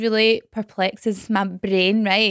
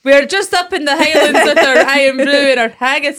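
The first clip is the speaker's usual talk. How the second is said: hammed-up Scottish accent, loud and laughing; exaggerated Scottish accent; 4 semitones higher, 7 dB louder than usual. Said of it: "This is embarrassing".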